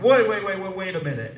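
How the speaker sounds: high, loud call to stop him; imitating calling out to a roommate, high and loud; retold shout. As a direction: yell wait after the roommate. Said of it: "And I'm like".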